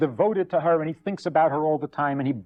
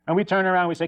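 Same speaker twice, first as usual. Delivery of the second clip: ordinary delivery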